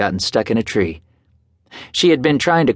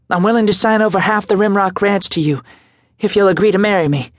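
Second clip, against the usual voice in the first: higher, rushed nervous plea; breathless fright; 10 semitones higher than usual. A narrator's reading. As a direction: rush it nervously, short of breath. Breathless proposal, racing.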